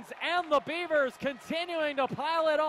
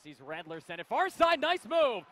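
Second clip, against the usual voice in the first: ordinary talk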